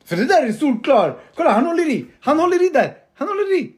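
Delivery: high, loud, insistent arguing; heated protest. Real, not acted. Fan arguing that the keeper is held, heated protest. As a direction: insist on the foul, voice shooting up. Arguing a foul while watching replay.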